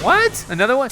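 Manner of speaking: high incredulous squeak; disbelieving what. Retold or imitated. Player hit again, high disbelieving squeak. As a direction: squeal in disbelief at another trap. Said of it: "I'm like".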